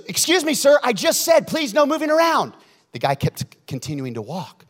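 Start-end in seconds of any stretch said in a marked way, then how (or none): 0.1-2.5 loud, high-pitched mock indignation; upset pastor. Pastor to a man walking out, loud and upset. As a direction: act out a preacher scolding from the platform. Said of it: "he gets upset on the platform".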